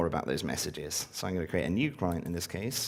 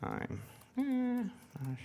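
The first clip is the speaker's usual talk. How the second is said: slow, quiet, halting words while typing; 2 semitones lower, 9 dB quieter, much slower than usual. Real, not acted. Speaker typing a thank-you message live on stage, slow quiet typing voice. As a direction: murmur each phrase as you type it. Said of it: "that's my typing voice".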